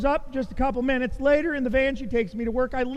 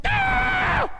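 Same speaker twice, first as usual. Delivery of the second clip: sudden very high burst of a yell; frustrated yell. Retold or imitated. Preacher retelling his flat tire to congregation, high frustrated yell. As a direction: let out a yelp of rage at the flat tire. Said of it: "I'm like".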